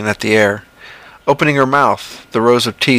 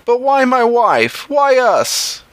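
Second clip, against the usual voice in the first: much higher, slow anguished cry; John crying out; 13 semitones higher, slower than usual. Acted. John cries out to the creature over his wife, high and anguished. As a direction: cry out in grief for the lost wife. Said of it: "John cried".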